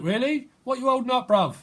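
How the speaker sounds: high-pitched mock disbelief; acted sceptical squeak; incredulous questioning. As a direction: question a mate, pitched right up. Acted incredulous reply in a dialogue.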